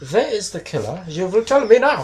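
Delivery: high, slow stern interrogator voice; hammed-up domineering interrogation; mock dominatrix. Spoofing the dominatrix episode, hammed-up stern interrogator. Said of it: "rubber latex and a German accent with whips".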